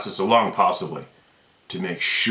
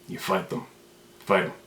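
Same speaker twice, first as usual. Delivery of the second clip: urgent whisper; 4 dB quieter than usual. Real, not acted. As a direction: breathe it out as an urgent hushed command. Rallying viewers to fight.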